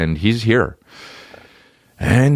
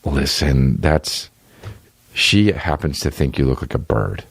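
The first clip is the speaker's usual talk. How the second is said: lower, patient letdown; Jesus letting him down; 7 semitones lower than usual. Retold or imitated. Jesus telling the comedian the bad news, lower and patient. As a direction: gently break the bad news. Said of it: "and Jesus is like".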